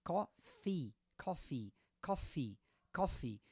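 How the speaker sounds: coffee with a clear final I sound; English coffee. Shown as a demonstration. Teacher models coffee for Brazilian learners, ending on I. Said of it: "esse símbolo aqui diz que tem o som I".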